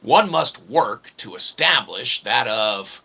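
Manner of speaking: ordinary talk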